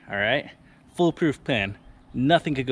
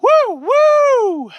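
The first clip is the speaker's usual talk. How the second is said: high loud choo-choo whistle; train whistle; 11 dB louder than usual. Acted. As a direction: toot like a steam train. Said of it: "like a train".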